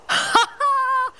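high, gleeful elf giggle. Acted. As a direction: cackle like a pleased little elf. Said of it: "rigole le lutin".

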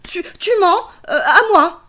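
high loud stammering snake. Acted. Snake speaks.

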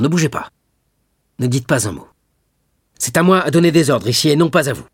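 loud, high, fast rebuke; sharp command. A narrator's reading. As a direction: order him to stay put. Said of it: "en se levant vivement". (ordre sec et autoritaire)

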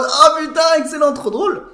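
very high, loud mock hysterics; imitated uproarious delight; whole crowd cracking up. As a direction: squeal it like classmates falling about. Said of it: "Tous par terre en mode".

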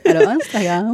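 imitation d'une voix sensuelle, un peu moqueuse; imite-la en voix suave et taquine. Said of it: "Tu prends ta do-, ta voix sensuelle". (voix sensuelle imitée pour taquiner)

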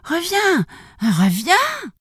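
put-on high-pitched old lady's call. A narrator's reading. Old lady calls after the runaway gingerbread man, high cry. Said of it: "en lui criant".

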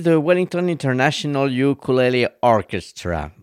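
name said in a mock-learned English accent. Shown as a demonstration. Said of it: "avec mon petit accent anglais". (avec un petit accent anglais de savant)

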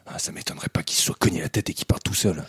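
hushed sneaking whisper. Acted. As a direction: whisper so they don't hear. Sneaking up on creatures.

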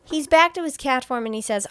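normal talking voice

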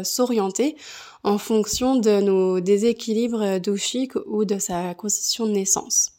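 ordinary delivery

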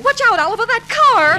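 sudden high yell of warning; alarmed shout. Acted. Wife yelling at husband about the oncoming car. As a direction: scream a warning at the driver.

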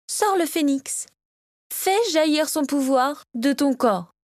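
high, drawn-out urgent order; commanding. A narrator's reading. Victoria orders her son to release the phoenix, commanding. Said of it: "lui ordonna-t-elle".

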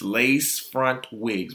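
lace front wigs drawn out slowly; slowed emphasis. Real, not acted. Mocking the trend to listeners, slowly. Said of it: "repeats phrase".

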